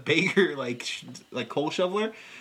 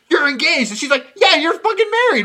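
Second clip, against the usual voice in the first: higher, louder hammed-up bickering; mock family quarrel; 8 semitones higher, 11 dB louder than usual. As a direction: yell it like a sitcom fight.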